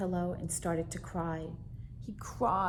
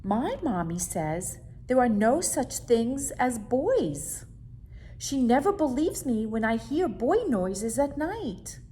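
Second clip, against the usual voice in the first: earnest little monster; 5 semitones higher, 5 dB louder than usual. Acted. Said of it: "he said".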